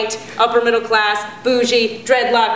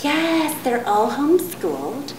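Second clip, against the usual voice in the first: Marin hippie mom; 3 semitones lower, slower than usual. Retold or imitated. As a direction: float it out, smug and unhurried. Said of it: "they all order the same thing".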